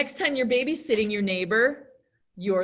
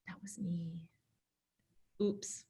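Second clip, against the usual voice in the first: whispered, very soft guilty confession; secret inner thought; 4 semitones lower, 14 dB quieter than usual. Acted. Babysitter privately owning up to the blame, whispering. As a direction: whisper it as if to yourself. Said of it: "deep inside, you gotta think".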